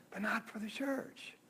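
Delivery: hushed whisper of a secret wish; whispered aside. Retold or imitated. Churchgoer admiring the gym, whispering the doubt. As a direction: whisper it as a private confession. Imagined churchgoer's private thought.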